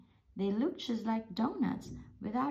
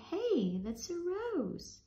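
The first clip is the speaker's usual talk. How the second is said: high cheerful nerve voice; 11 semitones higher, slower than usual. Acted. Nerves speak to the brain.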